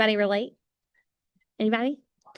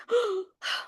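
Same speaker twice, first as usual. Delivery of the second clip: starstruck gasp; 11 semitones higher, 4 dB quieter than usual. Retold or imitated. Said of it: "I was like".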